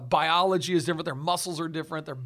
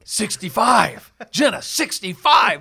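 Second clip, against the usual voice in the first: slow, booming title announcement; mock-epic shout, much louder and higher; dramatic loud announcement; 5 semitones higher, 11 dB louder, slower than usual. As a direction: belt the title out, drawn out. Repeating title, louder for effect.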